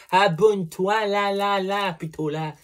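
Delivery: high, loud pointing out the button; bossy, playful shove to subscribe; loud playful command. Pretend. Loud, high teasing order to viewers to subscribe.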